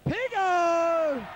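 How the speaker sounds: high, loud call across the ground; cheering shout. Real, not acted. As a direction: shout his nickname like a fan. Calling out to a player.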